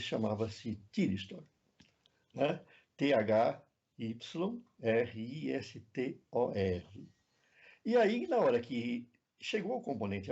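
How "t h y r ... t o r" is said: slow, letter-by-letter spelling. Shown as a demonstration. Professor spells an English component name for the audience, spelling out slowly. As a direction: spell it slowly, each letter set apart.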